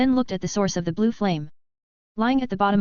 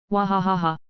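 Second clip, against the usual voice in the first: put-on playful childlike cackle; a mock childish giggle echoing; childish laugh. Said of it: "A childish laugh filled the sea".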